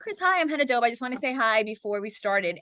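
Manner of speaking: bright, high self-introduction, easing back down; eager greeting. Retold or imitated. Anchor introducing herself to Chris Evans, high eager hello. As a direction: chirp your name at the star, nervous but polite.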